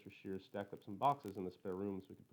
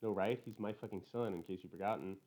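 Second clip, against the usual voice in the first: usual speaking voice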